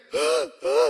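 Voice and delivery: sharp high gasping sob; imitating gasping sob. Father imitating son's wailing, gasping sob. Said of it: "이렇게 우는 소리가 들립니다".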